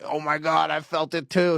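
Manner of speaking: mock panic. Man admitting he felt the ghost, mock panic. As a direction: blurt it out in pretend fright.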